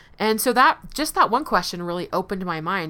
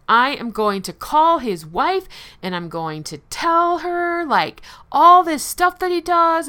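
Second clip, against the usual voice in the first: higher, louder vengeful threat; a retold furious threat to tell the wife; 9 semitones higher, 6 dB louder than usual. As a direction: spit it out like revenge is sweet. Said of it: "I was just really vengeful".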